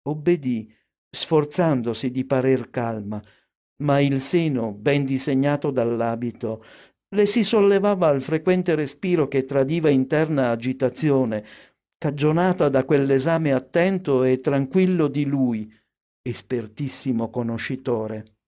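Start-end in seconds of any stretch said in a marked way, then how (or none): none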